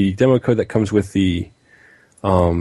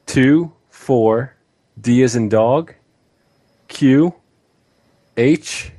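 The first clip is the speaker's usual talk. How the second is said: slow letter-by-letter dictation; spelling it out; slower than usual. Shown as a demonstration. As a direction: read out each character clearly, rising on each. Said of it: "so I hope you have a pen and pen, paper ready".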